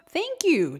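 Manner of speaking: high and loud delighted thanks; excited squeal. Acted. Tiny shrimp thanking the lobster for shelter, excited squeal. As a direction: burst out with joy. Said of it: "The tiny shrimp squeals with excitement".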